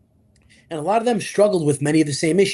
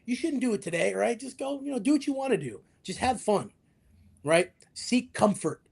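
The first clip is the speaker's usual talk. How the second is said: a higher, lazy tempting voice; mock devil, high and coaxing; 6 semitones higher than usual. Host voicing the shoulder devil to the listener, high and coaxing. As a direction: coax like the devil on your shoulder. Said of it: "That's that devil on the shoulder".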